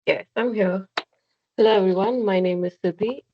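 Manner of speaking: normal talking voice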